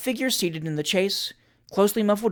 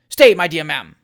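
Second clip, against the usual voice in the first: louder, urgent plea; urgent restraint; 10 dB louder than usual. Acted. Trott stopping Julia calling the post-boys, urgent. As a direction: stop her quickly.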